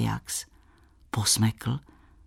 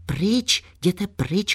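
shouting; 7 semitones higher, much slower than usual. A narrator's reading. Bára shouting at the hunter to keep away. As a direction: call out sharply in warning. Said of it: "křičím na něho".

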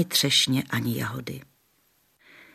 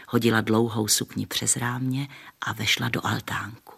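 ordinary delivery

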